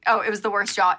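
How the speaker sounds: ordinary delivery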